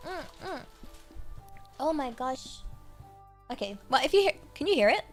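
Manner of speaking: usual speaking voice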